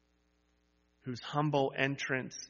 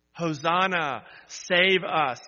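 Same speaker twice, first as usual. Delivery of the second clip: loud, higher cry to be saved; crowd shout; 3 semitones higher, 7 dB louder, slower than usual. Acted. Preacher voices the crowd shouting to Jesus, loud. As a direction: call out like the crowd begging. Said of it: "they shouted to Jesus".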